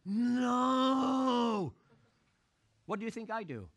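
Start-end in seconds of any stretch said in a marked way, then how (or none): 0.1-1.7 high, loud dismayed "No!"; crowd groan. Retold or imitated. Crowd watching the penalty miss, loud high groan. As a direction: groan "No!" like a whole stadium. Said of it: "I hear the crowd going".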